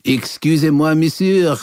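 put-on high hammy French accent. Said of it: "bad French accent".

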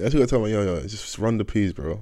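usual speaking voice

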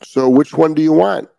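loud demanding question, rising on want. Real, not acted. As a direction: press the owner hard for an answer. Challenging owners who rile dogs.

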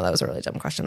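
whispered, dropping to near silence; sheepish mutter. Retold or imitated. Admitting to her husband she asked a dumb question, whispered. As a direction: mutter it, embarrassed at yourself. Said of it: "I'm like".

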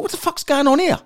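high-pitched bewildered outburst; mock disbelief. Man baffled at being offered an old woman's hand, hammed-up disbelief. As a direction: pitch it right up, utterly baffled.

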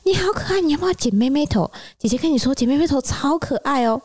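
high, sugary voice selling a bob cut; coaxing hairdresser. Retold or imitated.

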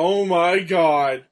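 loud, slow astonishment; amazed exclamation. Real, not acted. Reacting to a huge wrestling finish, loud slow amazement. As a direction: draw it out loudly in disbelief.

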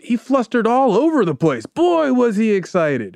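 hammed-up gushing quote; quoted excitement. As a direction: play up the clerk's amazed report.

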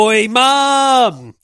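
loud, high Australian "Oi, mum!"; Aussie call. Retold or imitated. Correcting the lyric to proper Australian, loud high call. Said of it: "it should be like".

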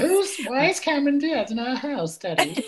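put-on slow, high-pitched little English child; drawn-out, piping mock child voice; English film child. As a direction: imitate a stage-school kid asking Daddy. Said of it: "The children are pure English children".

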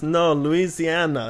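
put-on very slow, odd foreign accent; Wiseau accent. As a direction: stretch out Louisiana in a foreign accent. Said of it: "And he goes".

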